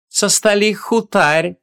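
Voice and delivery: high, slow, sing-out teasing voice; playful call. A narrator's reading. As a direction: call down playfully, stretching the words. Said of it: "игриво крикнули сверху". (игривый высокий протяжный оклик)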